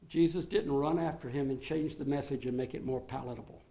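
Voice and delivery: normal talking voice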